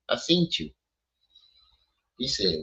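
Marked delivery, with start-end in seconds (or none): 0.1-0.7 lightly put-on impression voice; young Pharaoh. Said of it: "In my young Pharaoh voice".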